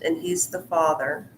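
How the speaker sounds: ordinary delivery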